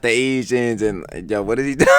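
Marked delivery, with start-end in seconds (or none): none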